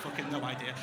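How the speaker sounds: ordinary talk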